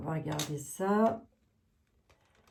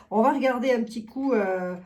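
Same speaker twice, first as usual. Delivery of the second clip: normal talking voice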